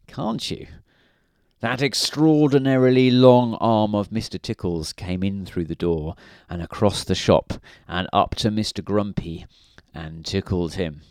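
ordinary delivery, nothing marked

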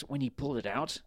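plain reading voice

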